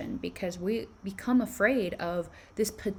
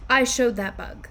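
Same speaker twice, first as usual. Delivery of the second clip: mock almighty; 3 semitones higher, 6 dB louder than usual.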